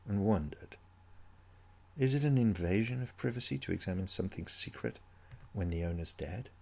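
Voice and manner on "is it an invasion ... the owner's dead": ordinary delivery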